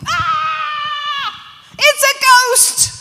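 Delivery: high, loud mock-panicked shriek; acted fright at full volume; terrified scream. Disciples scream at a supposed ghost.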